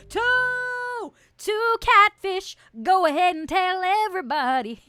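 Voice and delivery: high, loud celebratory holler; triumphant shout. Real, not acted. Streamer celebrates a second catfish, triumphant shout. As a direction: belt it out in triumph.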